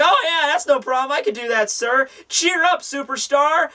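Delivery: high-pitched, chipper mock servant voice; eager sidekick. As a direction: cheer on your master in a high put-on voice. Voicing James agreeing to cheer master.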